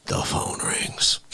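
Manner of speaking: usual speaking voice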